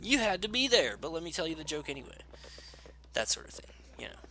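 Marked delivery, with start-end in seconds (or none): 0.0-2.2 high-pitched mock valley girl. Player acting out a joke line, mock valley girl. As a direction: put on a valley girl voice, quick at the end. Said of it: "I'm such a valley girl".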